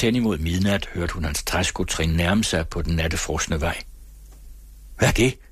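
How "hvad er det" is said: sudden louder startled question; half-frightened, louder exclamation. A narrator's reading. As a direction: jump at a shape in the dark. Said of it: "udbrød han halvt forskrækket".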